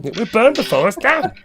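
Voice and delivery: mock English accent on a famous line; hammed-up English movie butler; Michael Caine impression. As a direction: do the mumbling English actor. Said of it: "the line that you kept saying over and over".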